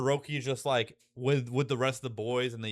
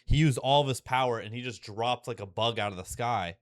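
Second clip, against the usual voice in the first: ordinary talk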